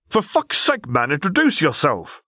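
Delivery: high-pitched exasperated outburst; exasperated order. A narrator's reading. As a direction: snap at a tongue-tied friend. Exasperated order barked into comm.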